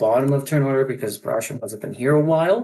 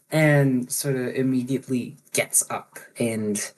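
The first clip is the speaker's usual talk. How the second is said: usual speaking voice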